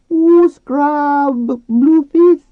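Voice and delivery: very high, loud squealed question; hopeful dolphin. A narrator's reading. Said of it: "Flo sounded hopeful".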